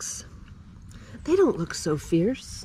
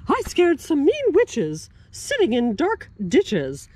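lightly raised spooky ghost voice; ghostie voice; 4 semitones higher, 5 dB louder than usual. Acted. Second ghostie brags about scaring witches, spooky voice. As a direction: boast like a proud little ghost. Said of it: "said ghostie number two".

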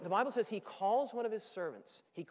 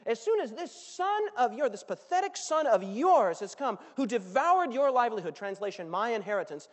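building louder, spitting resentment; bitter complaint; 9 dB louder than usual. Acted. Older son ranting at his father, bitter and building. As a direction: let the grudge swell into a rant.

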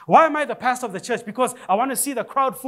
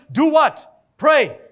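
louder punchy call to the church; call and response; 4 semitones higher, 5 dB louder, much slower than usual. Real, not acted. Preacher calling out to the congregation, loud and punchy. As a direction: drive the answer home loudly.

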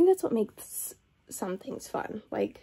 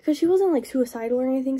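normal talking voice

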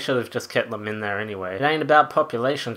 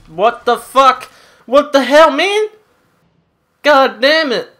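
raging gamer; 11 semitones higher, 10 dB louder than usual. Real, not acted. Angry at teammate losing.

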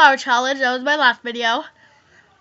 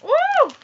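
loud high-pitched woo; 13 semitones higher, 4 dB louder than usual. Real, not acted. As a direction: blow out a high woo from the heat. Burning mouth.

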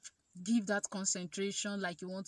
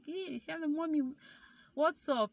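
cheery phone greeting; 7 semitones higher than usual. Retold or imitated.

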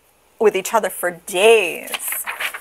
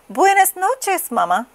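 put-on baby chick; 11 semitones higher than usual. A narrator's reading. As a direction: wish mama good night in a high little voice. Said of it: "said the baby chick".

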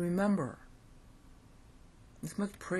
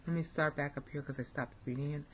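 ordinary delivery

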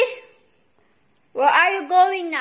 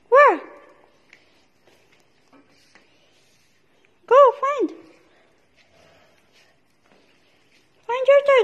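much higher, eager pet-talk throughout; excited urging; 10 semitones higher, 3 dB louder, much faster than usual. Real, not acted. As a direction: keep the dog hyped up, high and bouncy. Urging dog to find toy.

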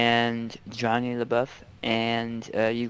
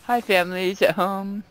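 high, bright presenter greeting; TV host voice; 9 semitones higher than usual. Pretend. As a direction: greet viewers like a show host.

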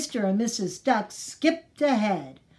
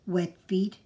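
grandpa asking gently; 2 semitones lower than usual. Acted. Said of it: "he asked".

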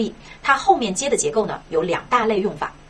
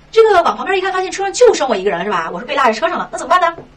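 high, loud mock alarm; raised, animated storyteller's dismay; alarmed realisation; 7 semitones higher, 6 dB louder than usual. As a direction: act out suddenly finding you've been left behind. Acts out being left on the bus.

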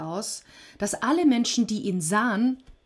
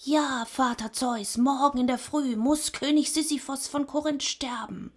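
thin voice of Death; 7 semitones higher than usual. A narrator's reading. Death answers Zeus from far below, thin-voiced. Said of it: "die dünne Stimme des Todes".

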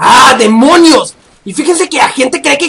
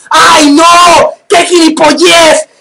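loud drawn-out angry outburst; frustrated shout; 3 semitones higher, 4 dB louder, much slower than usual. Real, not acted. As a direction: blow up at the frozen game. Game freezes and kicks him. (grito de frustración)

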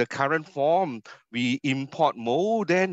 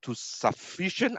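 "sufficient" lifted higher but said softer, set apart; stress on sufficient; 6 semitones higher, 6 dB quieter than usual. Shown as a demonstration. Lecturer spelling out the definition, stressing "sufficient". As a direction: underline "sufficient" with your voice. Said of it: "I underline the word sufficient".

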